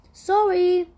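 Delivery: loud high sorry; hurried apology. A narrator's reading. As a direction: call out an apology. Doctor hurrying in.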